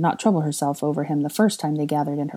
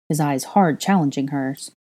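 ordinary delivery

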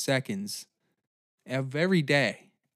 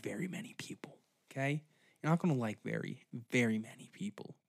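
whispered aside; 2 semitones lower, 8 dB quieter, faster than usual. Acted. Confiding aside mid-rant.

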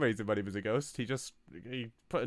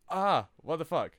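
startled outburst; 7 semitones higher, 5 dB louder than usual. Acted. Coworker startled by tram noise.

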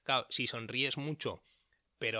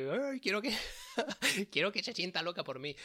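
much higher, giggling hammed-up flirt; mock seductress; 9 semitones higher than usual. Mocking a listener stripping to seduce: high, giggling flirt voice. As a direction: camp it up like an over-the-top seducer.

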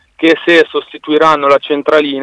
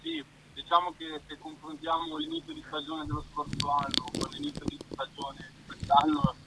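faint, distant phone voice; speakerphone; 19 dB quieter than usual. Real, not acted. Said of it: "Sei in viva voce". (in vivavoce, lontano e debole)

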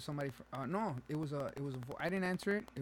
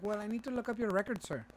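higher, officious agent voice; scam caller impression; 7 semitones higher than usual. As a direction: imitate an insisting phone agent. Acts out the scam woman.

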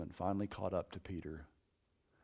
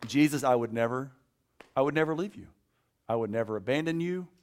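mock bragging vow, louder; boastful Peter; 5 semitones higher, 8 dB louder, faster than usual. Peter boasting to Jesus, mock bragging. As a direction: puff up like a braggart swearing loyalty. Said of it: "Peter's gonna brag and boast and say".

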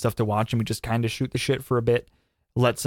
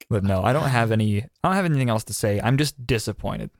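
ordinary delivery

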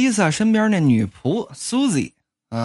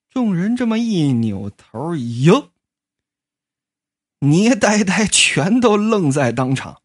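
usual speaking voice

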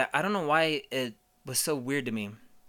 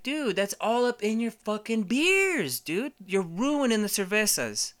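mock exasperated; 8 semitones higher than usual. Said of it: "I'm like".

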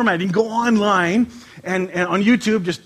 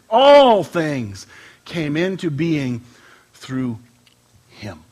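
scripture reading; 3 semitones lower, much slower than usual. Acted. Pastor reading John to the church, slow solemn scripture. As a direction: read it out like holy writ, slowly.

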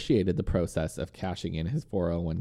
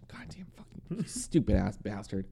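grumbling, softer curse; softer frustrated grumble; grumpy; 6 dB quieter than usual. Real, not acted. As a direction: mutter the curses in annoyance. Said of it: "I feel like you're grumpy".

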